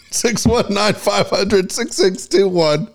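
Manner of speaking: slow, high, laughing read; laughing through numbers. Real, not acted. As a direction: giggle through the phone number. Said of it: "I choked on it".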